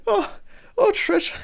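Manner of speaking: high-pitched, hammed-up laughter through the words; acted laughing. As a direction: play a lord laughing at the very idea, pitch leaping up. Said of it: "And he kinda laughs".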